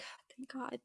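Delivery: very soft, almost under the breath; hushed relief. Retold or imitated. Wife recalls hearing help coming, hushed relief. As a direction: drop right down into a faint, relieved murmur.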